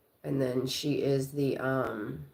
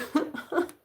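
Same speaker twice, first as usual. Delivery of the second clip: laugh; 13 semitones higher than usual. Real, not acted.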